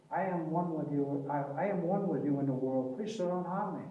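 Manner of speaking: soft, calm voice of the monk; gentle calm monk. Retold or imitated. A hint of the Tibetan monk gently speaking to the bees. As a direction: speak to the bees as one with them. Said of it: "So gently and so calmly".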